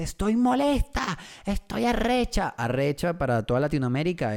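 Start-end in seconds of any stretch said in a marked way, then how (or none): 0.0-2.5 high mocking girlfriend voice, a bit slow; girlfriend's angry voice. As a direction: imitate her grumbling high and slow. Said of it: "me despierta y me dice".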